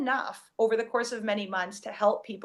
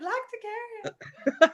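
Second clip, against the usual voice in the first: slow, much higher, teasing echo of "Lacticarium"; echoing the guest's excited "Lacticarium"; mock enthusiasm; 12 semitones higher, much slower than usual. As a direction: drag "Lacticarium" out high and playful. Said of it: "since you're so enthusiastic about it".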